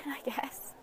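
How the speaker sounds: a quick breathy whisper, half to herself; whispered aside. Real, not acted. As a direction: drop to a whisper for the shrug. Wry aside after a laugh.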